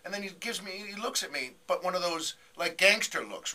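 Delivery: ordinary delivery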